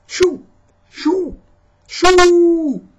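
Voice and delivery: very high, loud shoo. Acted. Papa Bear shoos bunnies from the garden, loud and high. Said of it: "said Papa".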